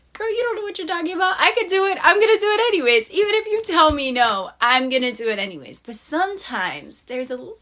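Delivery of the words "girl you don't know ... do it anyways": fast, high and loud, defiant; sassy retort, louder and higher; defiant talk-back. Acted. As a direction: snap back at the doubting voice, fired up. Talking back to the inner voice.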